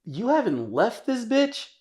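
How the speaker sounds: high, slow disbelief; stunned, drawn-out question; you haven't left her, in shock; incredulous. Retold or imitated. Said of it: "in my head, I was just thinking".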